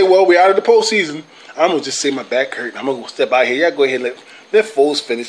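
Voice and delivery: a hint of a quarterback sneaking out of blame; imitating Carson Wentz. Vlogger mocking Wentz faking an injury, mild and low. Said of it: "He didn't want to be the one to say".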